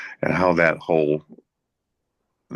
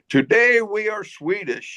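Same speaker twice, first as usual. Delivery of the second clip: much higher, slow, hammed-up drunken proclamation; drunk toast. Drunk football player toasting the room, high and slow. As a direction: raise the bottle and declare it like a tipsy giant.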